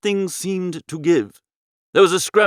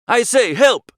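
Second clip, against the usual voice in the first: higher, louder cry of help; shouting for help; 6 semitones higher, 3 dB louder, slower than usual. A narrator's reading. Bertie calling to Bingo in the bush, shouting for help. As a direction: call out across the lake. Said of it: "I shouted again".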